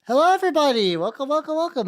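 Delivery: high, loud bright stream welcome; sing-song greeting. Acted. Streamer greeting the whole chat in a high excited voice. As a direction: greet the chat like a cheerful host.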